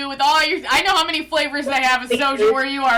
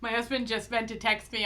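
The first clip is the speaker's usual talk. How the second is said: ordinary talk, nothing marked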